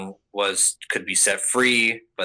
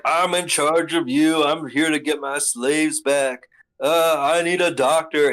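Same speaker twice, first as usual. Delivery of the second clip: higher, louder whiny noble voice; mock Celestial Dragon; 6 semitones higher, 4 dB louder than usual. Recapping anime, mocking a spoiled noble's demands. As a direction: play the spoiled tyrant demanding obedience. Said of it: "he's like".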